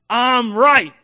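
a loud, high cry of certainty; shouted declaration. Real, not acted. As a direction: shout it to the whole church. Shouting in sermon climax.

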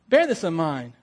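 normal talking voice

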